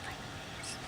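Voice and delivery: barely voiced whisper, almost no sound; breathless soundless whisper; silent mouthing. Shown as a demonstration. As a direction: mouth the words with no voice. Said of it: "I couldn't even hear a sound come out of it".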